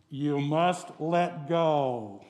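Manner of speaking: slow, calm divine command; slow voice of God. Retold or imitated. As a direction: lay it down slowly like God.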